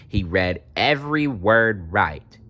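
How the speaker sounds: ordinary delivery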